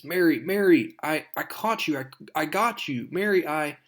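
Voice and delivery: a lightly voiced Spider-Man, stammering with worry; urgent hero voice.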